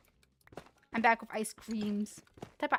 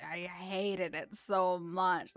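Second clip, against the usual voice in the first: slow, with "so much" drawn out; emphatic loathing; much slower than usual. Real, not acted. Sibling remembering chocolate cleanup, "so much" slow and emphatic. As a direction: stretch out "so much" with feeling. Recalling hated chocolate mess.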